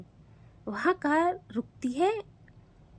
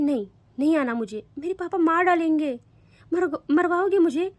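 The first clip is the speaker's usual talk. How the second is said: scared refusal; 5 semitones higher, 5 dB louder than usual. Acted.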